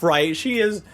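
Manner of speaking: usual speaking voice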